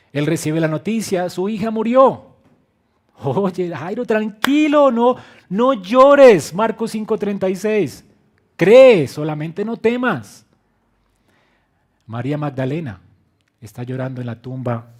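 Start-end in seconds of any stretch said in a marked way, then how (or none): 0.1-10.4 higher, much louder proclamation; preacher booming Jesus' promise to grieving listeners; full-force preaching. Acted. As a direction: declare "No llores" as a promise. Preacher voices Jesus to Jairo.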